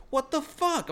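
high-pitched mock outburst; retold outraged disbelief. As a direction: blurt it high, acting out your shock. Retold outraged reaction to driver.

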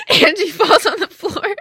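laughing. Real, not acted. Laughing while retelling.

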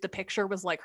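ordinary talk, nothing marked

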